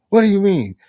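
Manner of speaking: high, loud, fast alarmed voice; mock the alarmed buddy, up high; alarmed Johnson. Retold Johnson's alarmed reply.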